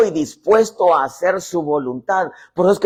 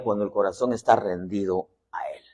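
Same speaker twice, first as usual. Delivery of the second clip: whisper; 8 semitones lower, 5 dB quieter than usual. Acted. Preacher drops to intimate point.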